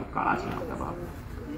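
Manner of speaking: whispered, slow and tender; soft whisper. Retold or imitated. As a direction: hush it like a sad confession. Tender reply to student. (हळू कुजबुजत, कोमल स्वरात)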